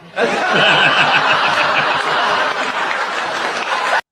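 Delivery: big loud burst of laughter. Real, not acted. Reacting to clip.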